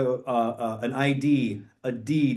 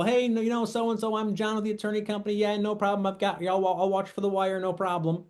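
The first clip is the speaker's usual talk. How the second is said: higher, softer, breezy reassuring caller; imitating a fake attorney; 6 semitones higher, 4 dB quieter than usual. Scammer posing as attorney calls buyer, higher and softer. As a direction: play the smooth scammer posing as an attorney, lightly put on. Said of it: "they might even have the attorney give you a call".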